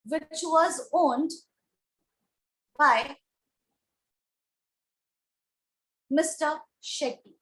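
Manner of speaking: word by word, with long pauses; slow dictation. Real, not acted. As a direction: dictate it slowly for a class writing it down. Dictating an example sentence while writing.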